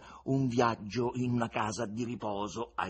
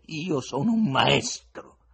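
slow, louder, solemn claim; grim and stern; 6 semitones higher, 10 dB louder, slower than usual. Acted. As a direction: declare it darkly with a threat. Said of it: "si incupì e minacciò Ivan col pugno".

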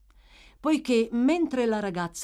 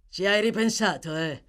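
a taunting growl, slightly raised; 2 semitones higher, 4 dB louder than usual. A narrator's reading. Brute eyeing the girl's tears, growled taunt. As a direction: growl the question as a challenge. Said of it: "ringhiò Sykes".

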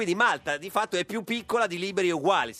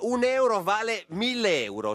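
showy game show host; 6 semitones higher, slower than usual. Retold or imitated. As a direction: announce it like a prize reveal. Host incredulously repeats the quote.

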